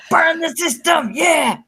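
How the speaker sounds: mock rallying shout. Mocking people who rally against society, with a hammed-up shout. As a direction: yell it like a mock rebel rally. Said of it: "be like".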